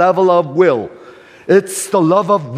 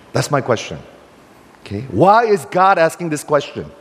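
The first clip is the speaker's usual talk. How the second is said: ordinary talk, nothing marked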